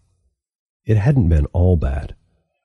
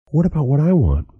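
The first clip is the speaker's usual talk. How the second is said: high, indignant question; petulant youth; 10 semitones higher than usual. A narrator's reading. Youth asking Crow, high and indignant. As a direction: squeak it up in protest. Youth demanding his wishes.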